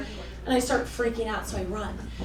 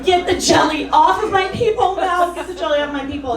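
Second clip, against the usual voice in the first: retold yelling; 6 semitones higher, 8 dB louder than usual. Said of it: "I kept yelling".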